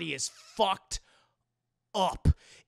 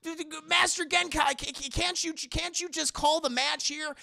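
high, stammering plea; panicking; 8 semitones higher than usual. Retold or imitated. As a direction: plead frantically, tripping over words. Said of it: "they're panicking".

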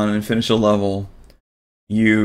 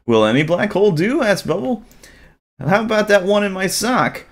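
put-on a bit higher boyish voice; lightly voiced boy; 10 semitones higher than usual. Bubble answers the creature, lightly boyish. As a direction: play the boy a notch up.

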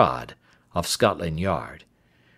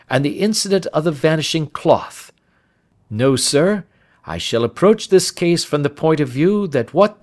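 higher-pitched, emphatic detective voice; animated sleuth laying out his reasoning; assured Holmes; 7 semitones higher, 4 dB louder than usual. Acted. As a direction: argue the case briskly and confidently.

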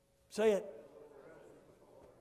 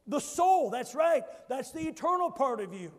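normal talking voice

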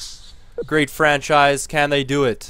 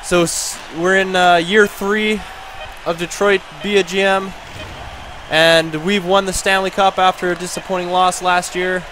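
proud victory announcement; 6 semitones higher than usual. Real, not acted. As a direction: announce the championship win with excitement. Announcing the Stanley Cup win.

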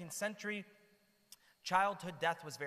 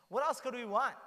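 louder and higher, full of wonder; excited exclamation; 6 semitones higher, 6 dB louder than usual. Real, not acted. Preacher marvels to his listeners, loud and awed. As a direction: burst out in amazement at such a gift.

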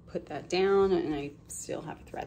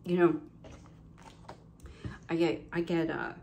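usual speaking voice

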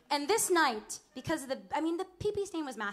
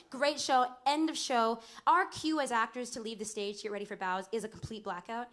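ordinary talk

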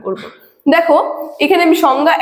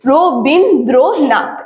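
রো বিন দ্রো নাথ, each syllable set apart; দল ভেঙে উচ্চারণ. Shown as a demonstration. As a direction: separate রো, বিন, দ্রো, নাথ to show the breaks. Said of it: "দেখো একেবারে রো বিন বলতে পারছি না". (রো বিন দ্রো নাথ আলাদা আলাদা করে বলা)

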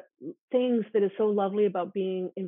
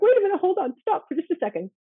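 urgent interruption; 9 semitones higher, 5 dB louder, faster than usual. Retold or imitated. Urgently stopping student again.

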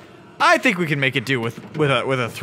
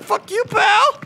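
very high, squawked comeback; mock angry retort; 4 dB louder than usual. Player answering the game, high mock retort. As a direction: throw it back in a high, hammed-up voice. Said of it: "And I'm like".

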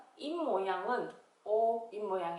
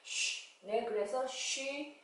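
쉬 whispered like a soft shush; shushing sound; 2 semitones higher, 6 dB quieter, slower than usual. Shown as a demonstration. Teacher imitating mom's shush for pupils, soft. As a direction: hush out 쉬 without voice. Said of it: "쉬 하던 그 소리예요".

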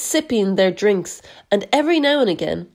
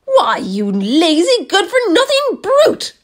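much higher, louder furious aunt; shouting; 10 semitones higher, 4 dB louder than usual. A narrator's reading. Aunt Spiker shouting at James, high and loud. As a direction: explode at the lazy boy. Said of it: "Aunt Spiker shouted".

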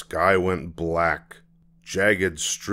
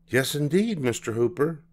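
mother voice; 7 semitones higher than usual. Acted. Mama voiced.

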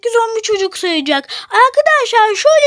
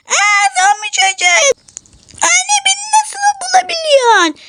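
put-on high, surprised child character; 7 semitones higher than usual. Zombie child asks his mother how she found him, high voice. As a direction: squeal it as a caught child.

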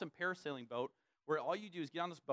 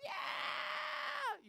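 crowd cheer. Retold or imitated. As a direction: cheer like a whole beach crowd.

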